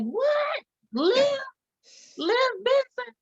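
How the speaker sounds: high, squealing disbelief; retelling the moment she heard about Liv Benson, shrieking high surprise; starstruck disbelief. Retold or imitated. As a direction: gasp it out like you can't believe who it is. Starstruck retelling of learning about Mariska.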